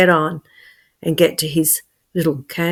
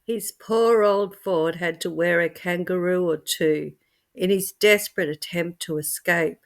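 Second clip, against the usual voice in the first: usual speaking voice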